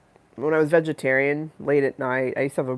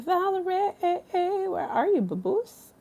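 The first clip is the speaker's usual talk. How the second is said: high, drawn-out sing-song calling; slower than usual. Pretend. Calling a viewer in chat, high sing-song voice. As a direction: call her name like a cartoon character.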